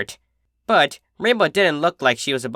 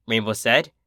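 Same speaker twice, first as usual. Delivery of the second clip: plain reading voice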